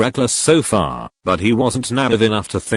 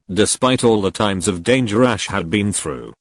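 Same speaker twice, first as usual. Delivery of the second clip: plain reading voice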